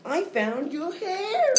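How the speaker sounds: high, slow worried mother. Acted. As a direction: ask it like an anxious mom. Mother calls out.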